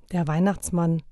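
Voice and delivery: ordinary delivery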